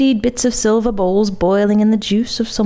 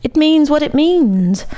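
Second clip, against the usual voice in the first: higher-pitched, cold retort; 7 semitones higher, 4 dB louder than usual. A narrator's reading. Count answering the limp maestro, coldly. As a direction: answer coldly, shutting the question down. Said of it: "said the Count coldly".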